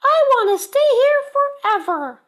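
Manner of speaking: beam it out high with joy; Missus Bird. Acted. Missus Bird proud of her nest, very high and loud. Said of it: "said Missus Bird".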